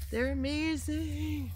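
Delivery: high, slow awe; delighted awe. Real, not acted. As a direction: marvel at the squashes. Admiring the squash.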